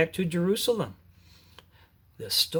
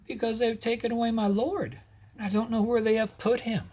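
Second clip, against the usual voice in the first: tearful plea; 7 semitones higher than usual. Acted. Weeping Mary answers the angels.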